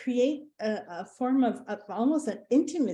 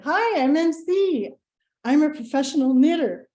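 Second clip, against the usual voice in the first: higher, louder, slowed-down cheery greeting; a proud intro meant to shock the room; bright upbeat intro; 4 semitones higher, 6 dB louder than usual. Retold or imitated. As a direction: greet the group with bold, bouncy confidence. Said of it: "this is what I said".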